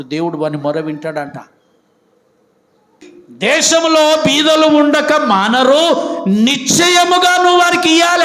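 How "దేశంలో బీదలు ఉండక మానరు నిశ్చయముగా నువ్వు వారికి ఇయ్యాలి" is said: much higher and louder, slow, like a decree; commanding order. Acted. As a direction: thunder it as God's order. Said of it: "order ఎత్తా ఉన్నాడు దేవుడు". (దేవుని ఆజ్ఞలా గట్టిగా)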